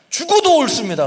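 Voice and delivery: very high, loud defiant retort; defiant shout. Acted. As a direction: talk back to God with veins popping. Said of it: "막 핏대를 막 하면서 막 하나님께 대드는".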